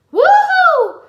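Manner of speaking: very high, very loud whoop; Jay cheering the rescue, high whoop. A narrator's reading. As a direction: let out a triumphant whoop. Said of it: "Jay whooped".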